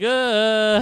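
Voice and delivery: high, loud drawn-out "uh"; mock groan. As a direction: imitate an exasperated moan. Acting out a character's wordless reaction.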